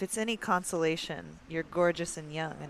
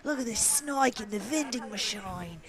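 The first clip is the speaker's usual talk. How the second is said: excited Australian wildlife host, a bit higher; mock Robert Irwin, excited Australian; Robert Irwin impression; 4 semitones higher than usual. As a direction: gasp at the snake like a nature host. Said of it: "Robert Irwin's TikToks".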